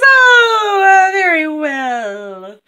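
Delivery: high, loud, drawn-out reluctant assent; Silver Tree. Acted. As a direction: give in with a swooping theatrical oh. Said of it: "And Silver Tree says".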